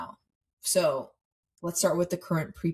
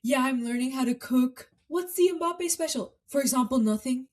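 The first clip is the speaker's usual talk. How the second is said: high, mock Mbappe voice; lightly hammed-up Mbappe impression; playful imitation of a footballer; imitating Mbappe; 6 semitones higher than usual. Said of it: "the video of him saying".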